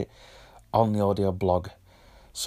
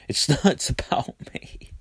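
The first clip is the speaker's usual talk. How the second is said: laughing; 4 semitones higher than usual. Real, not acted. Laughs at her own joke.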